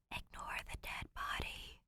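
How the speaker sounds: unvoiced, hushed joke; whispered aside. A narrator's reading. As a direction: whisper it like a secret. Whispered chapter subtitle aside.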